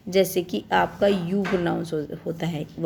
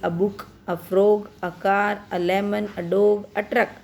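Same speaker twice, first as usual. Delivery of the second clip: slow reading; much slower than usual. Shown as a demonstration. Teacher reads examples to children.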